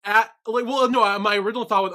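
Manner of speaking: flustered loud. Real, not acted. Flustered defending herself.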